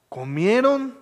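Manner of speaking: ordinary delivery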